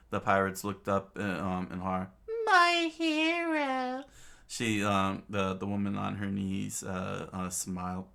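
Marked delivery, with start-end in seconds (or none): 2.4-4.0 very high, drawn-out falsetto swoon; slow, louder high-pitched gush; adoring damsel. Acted. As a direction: swoon over your hero in falsetto. Kneeling woman adores her rescuer.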